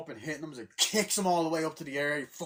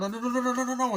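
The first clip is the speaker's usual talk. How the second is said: high, loud, rapid no's; yelped pleas, played for fun; mock panic; 11 semitones higher, 7 dB louder, faster than usual. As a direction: imitate frantic begging in a cartoon fight. Acting the pinned fighter panicking.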